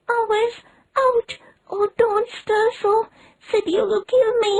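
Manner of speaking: put-on milk every word like a dying child.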